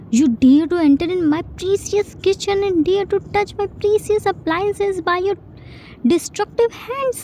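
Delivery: fast, rising to very high outrage; outraged scolding. Acted. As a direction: tear into him for touching your things. Jin scolding Jun over kitchen.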